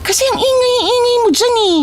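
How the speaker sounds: very high, quick whining sister voice; bratty twin, exasperated. Acted. Answering her nagging twin about men, high-pitched exasperated whine.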